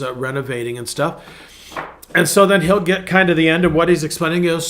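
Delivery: normal talking voice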